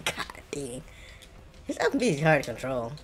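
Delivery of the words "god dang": breathy laugh through the words; laughing whisper. Real, not acted. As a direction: whisper it while chuckling. Laughing at own misplay.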